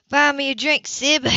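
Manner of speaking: brash woman demanding a drink; Gloria's voice. A narrator's reading. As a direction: toss it out brashly to her sister. Gloria flirting brassily.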